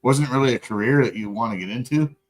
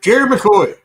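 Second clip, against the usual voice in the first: mock scolding; 8 semitones higher, 11 dB louder than usual. Mock scolding by full name.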